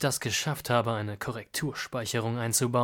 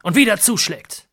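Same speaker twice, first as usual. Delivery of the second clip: sudden higher, louder dramatic shout; 10 semitones higher, 14 dB louder than usual. Acted. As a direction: burst out with the punchline. Dramatic narration climax.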